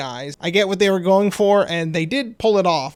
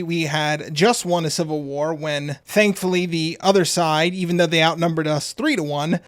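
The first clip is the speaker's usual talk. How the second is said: ordinary delivery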